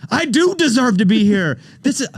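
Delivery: hammed-up self-important reply; mock triumphant. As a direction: puff up with overblown pride. Imagined triumphant reply to Reba.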